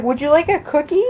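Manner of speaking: quick, high mock mom offer; imitating mom. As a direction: chirp it like a hovering mother. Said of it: "my mom's like".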